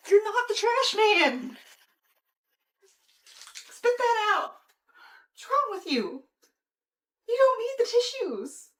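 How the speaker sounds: high, loud exasperated scold; scolding the cat. Real, not acted.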